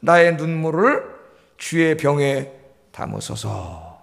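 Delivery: hushed, very slow recitation; slow scripture reading. Shown as a demonstration. Preacher reciting David's tearful prayer, very slow and hushed. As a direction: let the tears of the verse slow you down. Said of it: "뭐라고 기도를 하냐면은".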